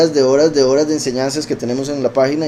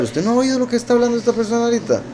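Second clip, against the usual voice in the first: imitating an alarmed relative, high and fast; high-pitched, rushed mock disbelief; put-on high voice, urgently tattling to family; scandalized relative; 10 semitones higher, faster than usual. Acting relative gossiping to another.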